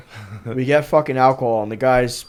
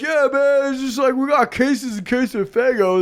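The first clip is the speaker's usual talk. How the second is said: high, upbeat dude voice; much higher, casual bragging tone; eager promoter; 9 semitones higher than usual. Retold or imitated. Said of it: "He's like".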